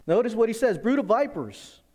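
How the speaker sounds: high, loud pulpit cry; fiery preaching. Acted. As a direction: hurl it at the crowd like John. Preacher quotes John, raised.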